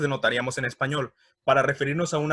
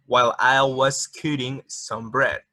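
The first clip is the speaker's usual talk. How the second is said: slow, careful English example; slow reading; 3 dB louder, slower than usual. Shown as a demonstration. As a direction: read the clause slowly for learners. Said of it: "reading example slowly".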